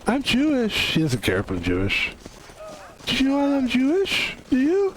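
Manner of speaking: high, cooing pet voice; talking to a dog. Real, not acted. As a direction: coo at the dog like a happy owner. Greeting a dog in game.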